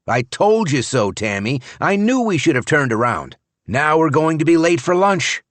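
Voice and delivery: wife scolding about being late; wife, told you so. A narrator's reading. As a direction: needle your lost husband. Tammy scolds on the drive.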